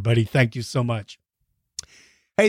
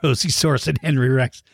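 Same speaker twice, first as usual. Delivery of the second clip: ordinary talk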